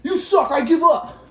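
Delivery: high, slow, scornful dad voice; mocking dad. Dad giving up on his son, high and slow. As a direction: drag it out like a fed-up father. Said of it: "He's like".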